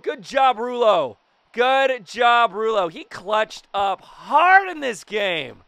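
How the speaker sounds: high, loud congratulations; loud post-win excitement; elated praise. Real, not acted. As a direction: cheer on the winner, stretching the words. Praising self after win.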